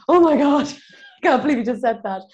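high, loud, fast shocked exclamation; retelling a shocked reaction, pitched high and loud. Retold or imitated. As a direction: gasp out the disbelief, laughing.

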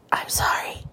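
breathy apology before a cringe clip; whispered aside. Real, not acted. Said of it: "whispered aside before the clip".

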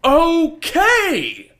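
high, loud blown-up okay; sudden high-pitched retold shout; exasperated yell. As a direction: shout it back, fed up. Retold explosion back at her.

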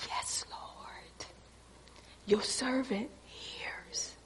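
slow, voiceless whisper; whispered reverence. Acted. Servant answering God who speaks softly, slow whisper. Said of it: "even above a whisper, you say".

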